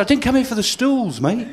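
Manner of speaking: put-on high, cheeky drunk protest; lightly voiced tipsy punter; cocky drunk. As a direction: argue with the barman, chirpy. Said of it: "I said".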